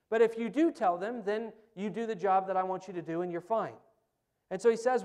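impassioned pulpit preaching. Acted. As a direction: drive the message home to the congregation. Preaching at full force.

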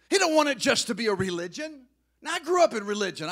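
rally the beggars to the bread; preaching at full force. Real, not acted. Preacher calling all the beggars to the living bread, loud and rising.

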